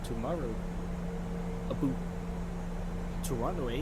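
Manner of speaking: a playful hint of a Canadian accent, ending on "eh"; mock Canadian accent. Pilot jokes to his viewers in a mild Canadian accent. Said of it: "a Canadian accent".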